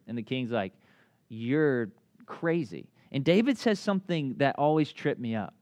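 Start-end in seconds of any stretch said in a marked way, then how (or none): 1.3-2.9 slow, low disbelief; incredulous king. Retold or imitated. King answering a shepherd boy's boast, incredulous.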